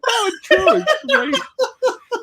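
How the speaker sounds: very high, squealed insistence; excited mock insistence. Dad insisting to his laughing kids, retold high excited squeal.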